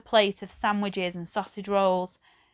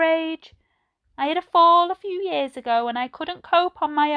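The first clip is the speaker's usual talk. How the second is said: put-on old lady voice; 7 semitones higher, 5 dB louder than usual. A narrator's reading. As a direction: voice her like a gentle elderly lady. Old Edna talks to Ben.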